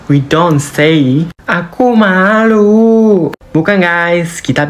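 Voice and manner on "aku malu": high, drawn-out bashful cry; acted shyness. Teacher showing how shy people talk, high and bashful. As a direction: play embarrassed, stretching it out. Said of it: "Kalau kamu malu di Jepang".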